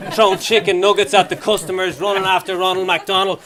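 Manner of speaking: normal talking voice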